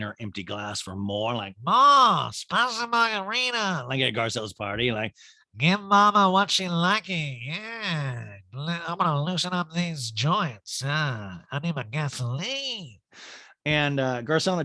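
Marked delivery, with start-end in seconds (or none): 1.6-3.9 put-on high, drawn-out drunken holler; hammed-up boozy demand; mock drunk diva. Recapper mimics drunk Erica demanding drinks. 5.6-13.0 slurry, drawn-out tipsy party voice; hammed-up boozy crooning; mock drunk diva. Recapper mimics drunk Erica drinking.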